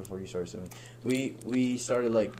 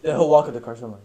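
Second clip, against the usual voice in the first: ordinary delivery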